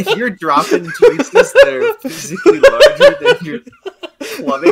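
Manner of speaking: high, loud laughter through words; laughing. Real, not acted. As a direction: laugh hard while speaking. Group laughing at poop joke.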